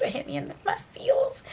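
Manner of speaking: soft, high, choked-up squeak; emotionally overwhelmed. Real, not acted. Reader telling viewers the book hit her, softly overwhelmed. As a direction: let the voice go tiny with feeling. Tearful late-night reaction to book.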